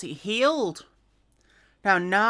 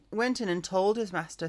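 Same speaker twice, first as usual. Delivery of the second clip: ordinary delivery, nothing marked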